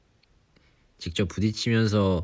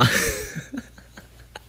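breathless laugh. Real, not acted. Laughs at own kkondae act.

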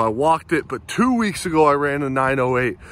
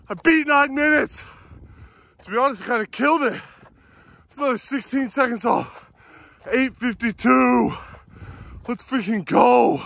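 breathless, high-pitched joy; elated; 8 semitones higher than usual. Real, not acted. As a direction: shout out the win, thrilled. Just broke nine minutes, elated.

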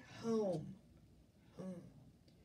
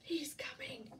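whispered storybook warning; hushed warning; 3 semitones higher, 5 dB quieter than usual. A narrator's reading. Giantess warns Jack, hushed warning. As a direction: drop into an urgent whisper.

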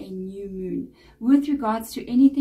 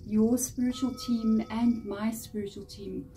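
soft, unhurried meditation voice; guided meditation; 6 dB quieter than usual. Acted. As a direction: guide the listener gently into relaxation, pausing between phrases.

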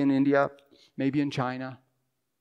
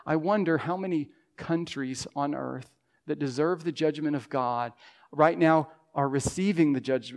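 usual speaking voice

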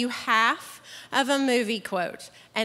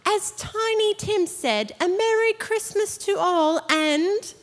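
put-on British accent; 8 semitones higher than usual. Host reading a movie quote to the crowd, mock British accent. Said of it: "let me get my British accent on".

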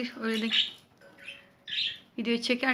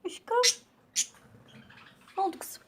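pet talk; 10 semitones higher, 6 dB quieter than usual. Real, not acted. High soft pet talk.

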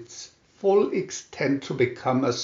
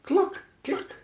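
high, soft hen cluck; 4 dB quieter than usual. A narrator's reading. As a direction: cluck gently like a little hen. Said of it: "called the little red hen".

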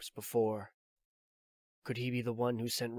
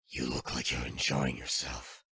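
put-on much lower, a weary old soldier; Snake's voice; 11 semitones lower than usual. Old soldier explains his pursuit to Drebin, low and weary. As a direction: drop into the tired veteran's low voice.

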